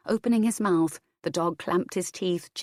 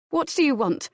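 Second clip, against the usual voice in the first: higher, sharp demand of an intruder; 9 semitones higher than usual. A narrator's reading. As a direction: snap it at him. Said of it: "confronting intruder".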